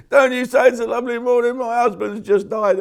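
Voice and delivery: a hammed-up outraged widow snapping back; offended widow. Grieving woman scolding a cheerful greeter, in a loud, high hammed-up voice.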